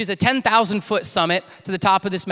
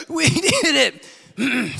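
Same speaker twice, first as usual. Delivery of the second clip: winded, gasping imitation of a cheer; high, broken cheer, acting out of breath; sucking air; 5 semitones higher, slower than usual. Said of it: "they're just sucking air".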